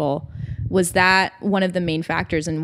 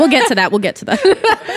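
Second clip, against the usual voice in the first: laughing repeat; 5 semitones higher, 3 dB louder than usual. Real, not acted. Host laughing at suggestion.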